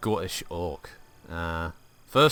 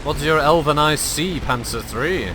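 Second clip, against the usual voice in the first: louder, higher mock-Scottish question; hint of Scottish; 5 semitones higher, 9 dB louder than usual. Gamer asking his tank crew what they see, mild Scottish lilt. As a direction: ask it in a light Scottish lilt. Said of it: "why do I sound like Carlisle".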